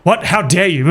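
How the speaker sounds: hammed-up offended gasp of protest; terribly offended. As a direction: imitate an affronted older customer. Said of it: "got terribly offended".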